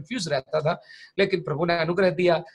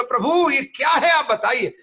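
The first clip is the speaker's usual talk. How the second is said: raised, higher exasperated plea to God; frustrated outcry; 8 semitones higher, 4 dB louder than usual. Retold or imitated. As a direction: demand an answer in frustration. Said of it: "मैं गुस्सा आ गया मेरे को".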